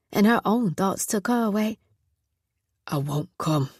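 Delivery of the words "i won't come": softer and lower, held-back tears; grief-soaked. Acted. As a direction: say it quietly, close to crying but not sobbing. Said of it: "His voice was soaked in grief".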